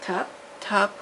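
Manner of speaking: ordinary delivery, nothing marked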